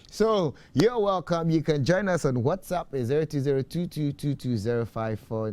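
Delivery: loud, high radio host plug; bright on-air announcer read. Acted. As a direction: call out the number like a radio host. Host's announcer call-in plug.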